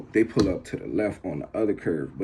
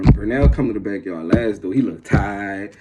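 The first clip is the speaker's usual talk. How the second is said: ordinary talk, nothing marked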